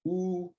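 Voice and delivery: high soft oo held alone; isolated vowel. Shown as a demonstration. Teacher isolates the oo vowel. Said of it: "the first one".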